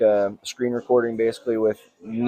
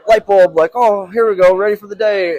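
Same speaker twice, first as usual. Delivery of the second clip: excited; 8 semitones higher, 7 dB louder than usual. Retold or imitated. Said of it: "when I'm like".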